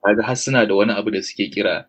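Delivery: ordinary talk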